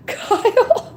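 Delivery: giggling high query of the name; laughing call. Shown as a demonstration. Streamer laughs while trying out name calls, giggly. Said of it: "Which kind of Kyle should I scream out".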